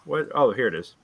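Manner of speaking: ordinary delivery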